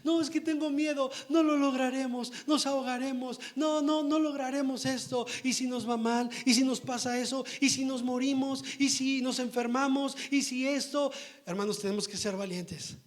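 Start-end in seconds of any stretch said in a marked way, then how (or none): 0.0-11.1 fearful worrier. Retold or imitated. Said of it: "todo nos atemoriza".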